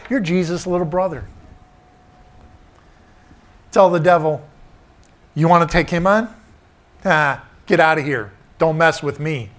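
emphatic, defiant preacher's voice; preaching. Acted. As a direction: dare the devil from the pulpit. Preaching, voicing defiance to devil.

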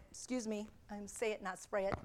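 low, soft throwaway remark; muttered aside. Real, not acted. Preacher excusing a spit to the congregation, muttered aside. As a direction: drop right down for a quick side comment. Aside about spitting while speaking.

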